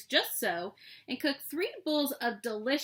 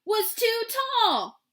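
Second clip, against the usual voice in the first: loud, high burst on "too"; emphatic punchline; 12 semitones higher, 7 dB louder than usual. A narrator's reading. Narrator revealing the third chair, loud exasperated punchline. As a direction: belt out "too", then drop on "tall".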